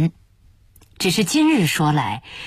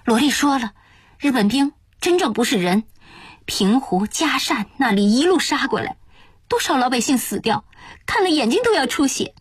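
lightly voiced worried aunt, higher pitch; higher-pitched, earnest aunt character; aunt's urgent warning; 7 semitones higher than usual. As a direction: warn with alarm in a raised voice.

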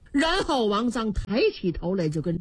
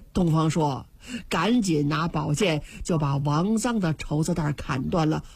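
ordinary delivery

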